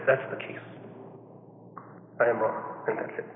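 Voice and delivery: soft, near-whispered, simply conceding; a hushed, almost breathless owning up; quiet admission. Pretend. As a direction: say it hushed and humble, no excuses.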